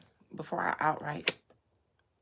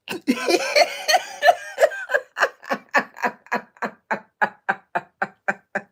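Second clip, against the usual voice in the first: loud, helpless laughing fit; long laughing fit; 7 semitones higher, 8 dB louder than usual. Real, not acted. Streamer cracking up over Elma, long real laugh. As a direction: burst out laughing and keep going.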